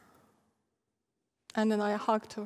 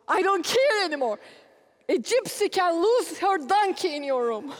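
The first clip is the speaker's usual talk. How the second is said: loud, higher exasperated rant; fed-up husband; 5 semitones higher, 3 dB louder than usual. Retold or imitated. Husband ranting about daughter's room, loud exasperated give-up. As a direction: give up on it out loud, half joking. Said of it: "he goes".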